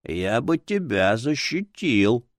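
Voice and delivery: slow, aged, reproachful book spirit; indignant old man's voice. A narrator's reading. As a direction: scold slowly as an offended old book. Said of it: "возмутилась книга старческим голосом".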